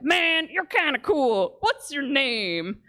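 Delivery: slow, tipsy fan making friends; drunk fan. Retold or imitated. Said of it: "The first guy went".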